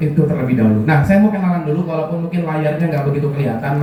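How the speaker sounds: normal talking voice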